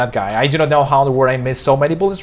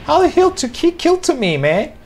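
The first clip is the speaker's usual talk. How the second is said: much higher furious disbelief; raging gamer. Real, not acted. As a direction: screech at the unfair kill. Gamer raging after dying.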